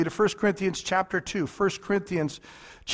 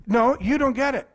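ordinary talk, nothing marked

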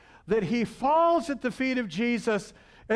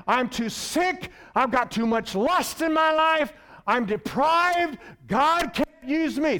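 higher, slow, anguished protest; pleading despair; 5 semitones higher, slower than usual. Acted. Peter refusing Jesus' call, anguished and pleading. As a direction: beg to be left out, voice straining up. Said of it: "Peter says".

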